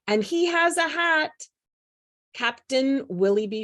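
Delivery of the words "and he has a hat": calling out. A narrator's reading. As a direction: call it out in mock alarm. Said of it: "called first mate Scratch Whiskers".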